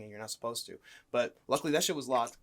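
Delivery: ordinary talk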